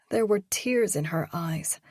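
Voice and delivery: normal talking voice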